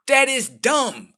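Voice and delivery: high, loud and slow, spelling out the dumbness; disbelieving rant; exasperated outburst. Real, not acted. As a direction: lay it out like it's obvious. Ranting about her order.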